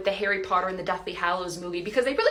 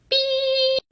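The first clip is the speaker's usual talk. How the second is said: high mock censor beep; a sharp mouth-made beep.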